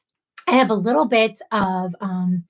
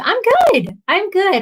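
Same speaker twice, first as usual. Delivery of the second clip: chirpy I'm good; 9 semitones higher, 3 dB louder than usual. Real, not acted. Answering a viewer.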